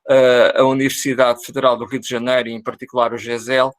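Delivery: normal talking voice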